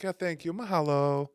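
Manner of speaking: high, fake-sweet Hawaiian brush-off; passive aggressive. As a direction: thank them just to dismiss them. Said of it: "they're very passive aggressive".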